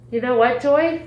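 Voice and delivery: rally yourself before surgery; rousing self pep talk. Retold or imitated. To herself before surgery, a loud rousing pep talk.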